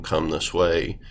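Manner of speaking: usual speaking voice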